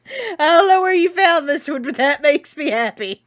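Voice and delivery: high, loud laughing delight. Real, not acted. Laughing at a fan's meme, delighted. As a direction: crack up while talking.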